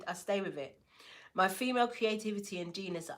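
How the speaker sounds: ordinary talk, nothing marked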